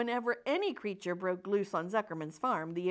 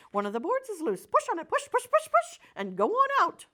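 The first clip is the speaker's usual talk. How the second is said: high, louder, rising push push push; urging goose; 12 semitones higher, 7 dB louder than usual. Acted. Goose urging the pig through the fence, climbing high and loud. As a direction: egg him on, pitch climbing each push.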